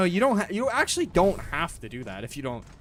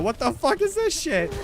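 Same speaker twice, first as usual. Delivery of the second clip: a much higher, startled cry of disbelief; shocked squeal; 13 semitones higher, 3 dB louder than usual. Real, not acted. Streamer sees the boss, high shocked cry.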